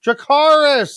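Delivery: high, loud hammed-up call; mock desperate command. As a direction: call out the dragon command theatrically. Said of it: "she had to say Dracarys".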